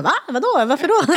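high, rapid-fire puzzled questions; baffled. Retold or imitated. Partner hearing she is sick of the place, baffled. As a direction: imitate a partner taken aback.

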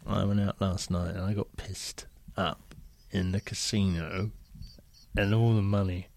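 low, soft embarrassed confession; Lovejoy. Acted. Actor confessing casino losses, low, soft and embarrassed. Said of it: "this is very embarrassing".

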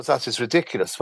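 usual speaking voice

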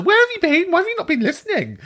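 high squealing mock scolding; mock outrage. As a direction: squeal it like a scandalised friend. Mock scolding old friend.

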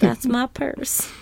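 slow, high, playful character voice; hint of a cartoon lady, stretched out; put-on cartoon voice. Joins in the purse impression.

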